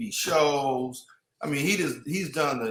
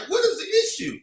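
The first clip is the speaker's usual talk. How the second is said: high-pitched incredulous question; exasperated mock plea. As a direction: voice jumps way up in disbelief. Impatient team quoting itself about delays.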